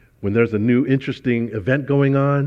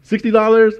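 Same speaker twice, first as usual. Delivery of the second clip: high, loud know-it-all mother; lecturing mom; 10 semitones higher, 6 dB louder than usual. Retold or imitated. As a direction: spell it out like a smug mom. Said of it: "my mom was like".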